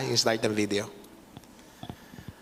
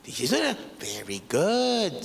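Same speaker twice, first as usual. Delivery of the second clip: slow, drawn-out very good; imitating potter's praise; slower than usual. Preacher imitating Nanay Tessie praising Hannah, slow and drawn out. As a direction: savor the praise like the old potter. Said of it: "Sabi niya".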